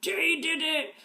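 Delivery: put-on high-pitched toddler accusing; toddler blaming. Child finding a ripped shirt, high toddler accusation. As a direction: point the finger, high and childlike. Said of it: "Or she finds a rip".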